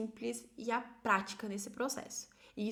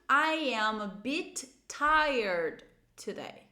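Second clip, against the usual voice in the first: very slow, drawn-out English sentence; slow pronunciation model; 5 dB louder, much slower than usual. Shown as a demonstration. Teacher models the second answer, very slowly drawn out. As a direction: stretch every word for learners. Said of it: "Ó, essa pronúncia".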